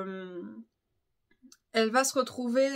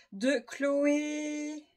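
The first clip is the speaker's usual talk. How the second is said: normal talking voice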